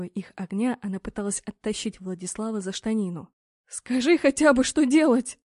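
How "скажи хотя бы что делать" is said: high, loud desperate plea; pleading cry. A narrator's reading. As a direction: beg for any instruction. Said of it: "взмолилась Эмма". (отчаянная мольба)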